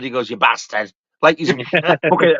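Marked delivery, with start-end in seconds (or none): 0.3-0.9 northern "bastard" with a short flat "a"; low, northern-accented "bastard". Retold or imitated. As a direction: imitate a northerner's "bastard", pitched lower. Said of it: "like a northerner".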